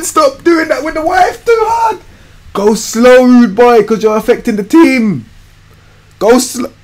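high, loud telling-off of a player; scolding shout. Acted. Gamer yelling at his own footballer, high shout. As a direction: yell at him to calm down, way up high.

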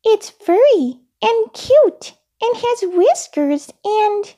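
high-pitched puppet voice; very high childlike character voice; Elmo, explaining. A narrator's reading. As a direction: explain earnestly in a small high voice. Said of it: "said Elmo".

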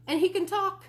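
put-on high, loud bragging kid; excited little boy. A narrator's reading. Fudge boasting about his talking bird, excited. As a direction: boast like an eager child.

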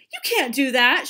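high, loud horrified protest; pleading. A narrator's reading. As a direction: plead with them to stop. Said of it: "she pleaded".

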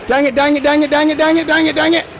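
high, rapid-fire monotone chant; frantic repeated grumbling. Pretend. Player failing in the hunt, high rattled-off complaint. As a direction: rattle it off on one high note.

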